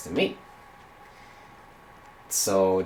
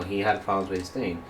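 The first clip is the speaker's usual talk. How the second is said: ordinary talk, nothing marked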